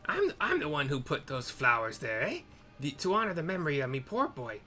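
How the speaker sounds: a hint of a scolding old woman; lightly voiced cranky old lady; haggard hag. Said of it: "Haggard hag".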